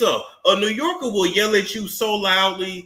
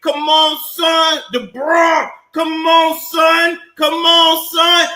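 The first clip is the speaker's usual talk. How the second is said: loud New Yorker impression; 10 semitones higher, 7 dB louder than usual. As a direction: bellow come on son like a loud New Yorker. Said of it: "you don't know if they yelling".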